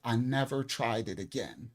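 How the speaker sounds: ordinary talk, nothing marked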